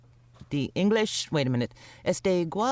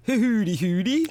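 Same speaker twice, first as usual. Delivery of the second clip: silly imitation of Dutch sounds; playful fake Dutch gibberish; hammed-up pretend Dutch; mock Dutch; 4 semitones higher, 4 dB louder than usual. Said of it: "What does it sound like when people speak Dutch".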